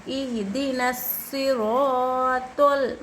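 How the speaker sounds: very slow, sustained Quranic chant; elongated recitation. Shown as a demonstration. Teacher reciting the whole verse for students, elongated chant. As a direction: hold each long vowel at length. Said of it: "एक अलिफ की मकदार खींचना चाहिए".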